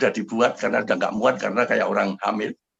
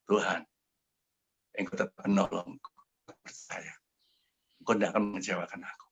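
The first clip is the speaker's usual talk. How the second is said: retold tearful prayer; 2 semitones lower, 7 dB quieter than usual.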